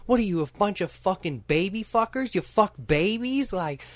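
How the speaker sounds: high-pitched mouthy girlfriend impression; imitated screaming girlfriend. Retelling movie girlfriend threatening strangers, mock screaming. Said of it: "and, like, starts screaming".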